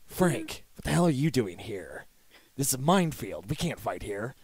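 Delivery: low, soft gravel-free hero murmur; Snake voice. Pretend. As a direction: go low and quiet like a tough soldier. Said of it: "me doing Snake".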